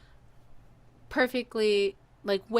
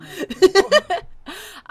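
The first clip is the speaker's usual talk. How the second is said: loud high laugh; 11 semitones higher, 12 dB louder than usual. Real, not acted. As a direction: burst out laughing at yourself. Laughs after the pancake joke.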